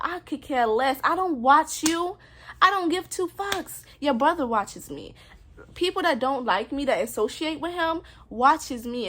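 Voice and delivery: angry outburst. Real, not acted. Ranting at his brother and friends who watch her, loud and angry. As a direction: yell it right at them, fed up. Furious rant at ex's circle.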